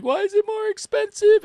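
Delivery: high squealing complaint, very high; whiny Karen voice. Retold or imitated. Mocking a complaining customer.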